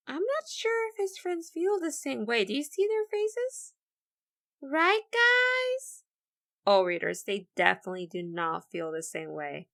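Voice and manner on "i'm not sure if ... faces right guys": high, bright bird voice rising on the guys; high character voice. Acted. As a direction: play the hopeful little bird, high. Teacher voicing Nerdy Birdie.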